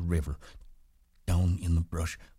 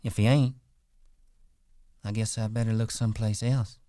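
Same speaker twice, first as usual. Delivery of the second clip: playful; 5 semitones higher than usual. A narrator's reading. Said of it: "she said play-, playfully".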